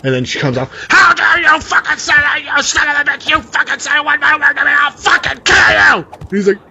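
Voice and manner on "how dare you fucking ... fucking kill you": high, loud raging shriek; furious scream. Retold or imitated. Girlfriend screaming threats at the brother, furious. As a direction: scream bloody murder at him.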